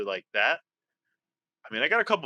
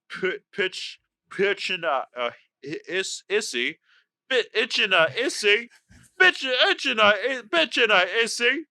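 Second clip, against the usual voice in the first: higher-pitched Cockney, dropping the H; British Cockney attempt; 5 semitones higher than usual. Player tests the phrase aloud, effortful Cockney accent. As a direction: stumble into an amateur Cockney, growing higher. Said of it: "the best British accent I've ever heard you do".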